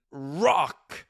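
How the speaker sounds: loud, high burst on one word; playful rocker's yell; mock rock-out. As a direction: belt it out like a rock fan. Acting out rocking to loud music.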